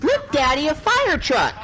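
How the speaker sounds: high-pitched mock child's voice; excited little boy. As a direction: call out to Daddy like a thrilled little kid. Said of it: "the little boy".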